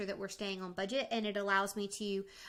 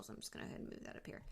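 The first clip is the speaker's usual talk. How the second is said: whispering; 14 dB quieter, much faster than usual. Real, not acted. Son asleep, quiet home.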